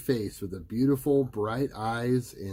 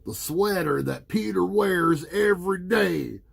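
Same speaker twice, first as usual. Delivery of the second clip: shouting; 9 semitones higher, 6 dB louder than usual. A narrator's reading. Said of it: "shouted Russ DeLuca".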